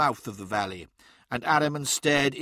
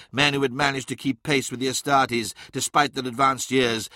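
ordinary delivery, nothing marked

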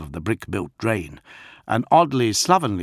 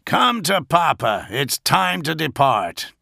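taunting yell; 7 semitones higher than usual. A narrator's reading. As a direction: shout it over gunfire. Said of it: "Damon yelled".

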